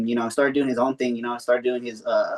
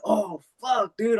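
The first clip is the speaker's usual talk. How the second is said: dismayed; 7 semitones higher, 3 dB quieter, much slower than usual. Retold or imitated. Realizing he's hallucinating.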